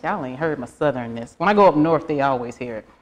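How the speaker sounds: ordinary delivery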